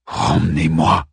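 low, menacing growl. A narrator's reading. Arman growling at Ariel to be taken back. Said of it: "gronda Arman".

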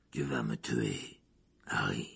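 low, slow, soft and resigned. Acted. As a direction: say it almost to yourself.

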